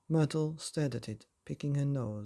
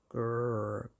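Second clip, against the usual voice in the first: growl; 3 semitones lower than usual. A narrator's reading. Big Fing growls.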